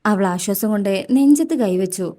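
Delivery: ordinary delivery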